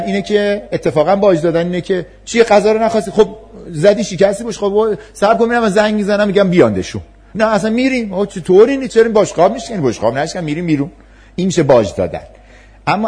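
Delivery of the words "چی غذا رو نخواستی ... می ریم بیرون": high, louder placating parent scrambling to please; pleading parent, anything to stop the tantrum; giving-in parent. Retold or imitated. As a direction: cave in to the child, pitched up and pleading. Acting a parent who gives in.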